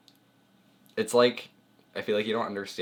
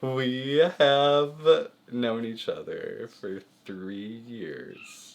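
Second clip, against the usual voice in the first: slow, stretched-out words after a laugh; drawn-out answer; 4 semitones higher, 4 dB louder, much slower than usual. Real, not acted. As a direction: drag every word out, starting higher.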